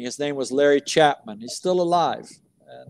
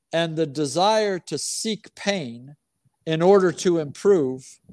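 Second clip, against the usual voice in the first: usual speaking voice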